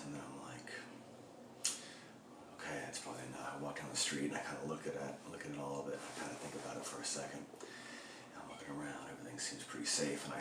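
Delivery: low, soft, secretive narration; hushed, stealthy telling. Retold or imitated. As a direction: tell it low and quiet, as if sneaking. Sneaking out to steal the mannequin.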